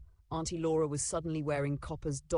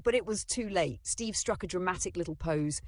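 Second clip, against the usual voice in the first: usual speaking voice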